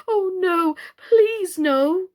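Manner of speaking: high, loud anguished cry. Acted. Doll Mister Plantagenet crying out against ordinary chairs, high and loud. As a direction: plead in alarm.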